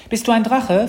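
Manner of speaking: put-on high, loud, curious child; little girl asking. A narrator's reading. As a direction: ask it like an excited little sister. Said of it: "fragte Mathilda".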